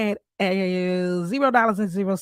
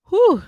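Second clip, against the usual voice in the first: a sharp high exhale, choked up; real emotional breath out; emotional whew; 9 semitones higher, 7 dB louder than usual. Real, not acted. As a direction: blow out the feeling before going on. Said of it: "story gets me a little emotional".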